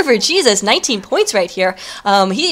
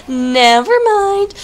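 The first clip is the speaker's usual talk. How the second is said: much higher and drawn out slowly; sing-song; 11 semitones higher, 3 dB louder, much slower than usual. Real, not acted. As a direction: stretch it out like a singsong tune. Caster repeats correction, sing-song.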